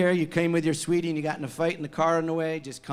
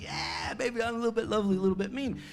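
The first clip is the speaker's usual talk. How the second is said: proud, a little higher, showing off; proud fan; 4 semitones higher, 6 dB quieter, much faster than usual. Retold or imitated. Fans quoting the song title, proud and boastful. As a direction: fans bragging about the song on their T-shirts, proudly. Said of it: "'cause they're proud of it".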